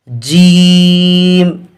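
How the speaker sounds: جیم with the vowel stretched long; جیم held long in a reciting tone; drawn-out letter name. Shown as a demonstration. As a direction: pull the ee in جیم out slowly. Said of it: "اس کو تھوڑا سا کھینچیں گے".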